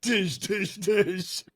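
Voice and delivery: lightly voiced friend chanting; imitating Glenn. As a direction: chant it the way your friend did. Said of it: "Glenn's like".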